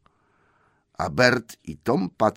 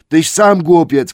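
slow, much higher, much louder yell; troll shout; 10 semitones higher, 12 dB louder, slower than usual. Acted. Tom shouts the insult back at William. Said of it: "krzyknął Tom".

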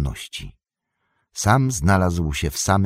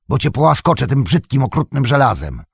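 mocking threat; 7 semitones higher, 5 dB louder, faster than usual. Sam mocks Gollum's own words.

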